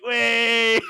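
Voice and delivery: loud, high, laughing wait; the director realising the prank, cracking up; laughing realisation. Retold or imitated. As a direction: break into a laugh as it dawns on you.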